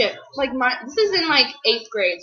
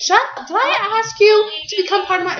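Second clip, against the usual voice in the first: high, heated telling-off of a sister; real flash of sibling irritation; sibling scolding; 9 semitones higher, 4 dB louder than usual. Real, not acted. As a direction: cut your sister off, pitched up and indignant. Snapping at teasing sister on camera.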